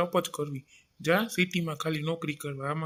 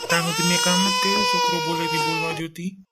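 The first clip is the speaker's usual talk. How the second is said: crying; 5 dB louder, faster than usual. Acted. Father breaks down in family quarrel.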